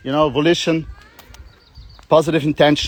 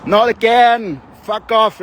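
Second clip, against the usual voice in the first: neighbours shouting; 5 semitones higher, 4 dB louder, slower than usual. Retold or imitated. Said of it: "they're like".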